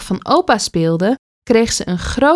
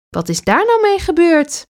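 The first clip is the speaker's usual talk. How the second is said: a much higher, amazed question; the mother can't believe her eyes; astonished; 9 semitones higher than usual. A narrator's reading. Mother sees clay in the guitar, astonished. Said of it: "vraagt haar moeder verbaasd".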